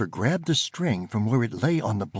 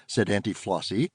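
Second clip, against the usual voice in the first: ordinary delivery, nothing marked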